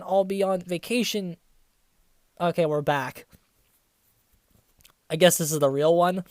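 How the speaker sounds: usual speaking voice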